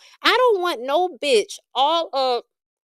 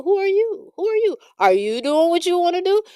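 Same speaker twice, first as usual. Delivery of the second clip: high, pointed "who are you"; higher-pitched incredulous call-out; incredulous challenge; 6 semitones higher than usual. Real, not acted. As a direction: demand it with disbelief. Challenging judgmental people.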